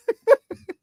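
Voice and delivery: high laugh. Real, not acted. Laughs at own water joke.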